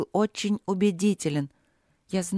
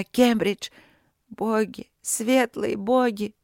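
anguished cry; 4 semitones higher, slower than usual. A narrator's reading. Despairing heroine cries out.

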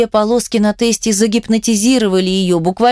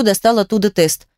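ordinary delivery

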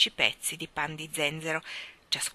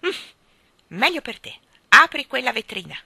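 loud, higher commanding crone; stern order; 6 semitones higher, 11 dB louder than usual. Acted. Old mother orders her daughter to open the case. Voices Mrs Corey ordering.

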